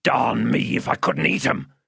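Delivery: rough man's menacing boast; threatening. Acted. Man threatening to eat the child, menacing. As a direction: threaten the child with real relish. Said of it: "with a threatening shake of his head".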